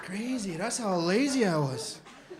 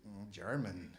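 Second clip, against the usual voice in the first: very soft, low reply; meek answer; 10 semitones lower, 13 dB quieter, slower than usual. Retold or imitated. Customer admits origin to doner guy, low and meek. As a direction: murmur it reluctantly.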